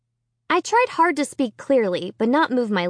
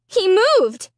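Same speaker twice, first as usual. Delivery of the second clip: frightened; 10 semitones higher, 5 dB louder than usual. Acted. Scared girl tells father.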